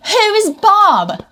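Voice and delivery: high, slow baffled question; puzzled, drawn-out "who is Bob"; confused. Retold or imitated. Baffled by British slang, high slow confusion.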